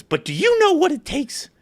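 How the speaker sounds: high, slow teasing question; mock dramatic question. Seatmate posing a big question to the storyteller, high and slow. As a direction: draw it out like a riddle.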